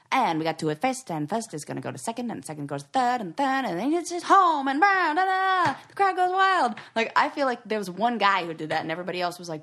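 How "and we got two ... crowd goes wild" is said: imitation play-by-play, building to a high, loud climax; baseball announcer. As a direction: call the game like an old-time commentator, rising to a shout. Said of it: "one guy who called baseball games".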